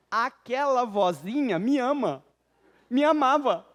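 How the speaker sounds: ordinary talk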